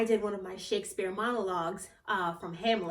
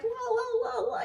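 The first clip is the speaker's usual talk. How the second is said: high, repeated whoas; re-enacted high-pitched astonishment; overwhelmed amazement. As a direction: react in giddy disbelief. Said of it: "I'm all like".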